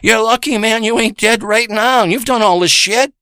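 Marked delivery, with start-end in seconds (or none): none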